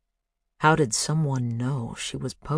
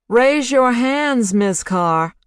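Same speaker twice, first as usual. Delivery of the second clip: higher, slow, called out over speakers; 9 semitones higher, 6 dB louder, slower than usual. A narrator's reading. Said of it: "Barbra Streisand's voice called out from overhead speakers".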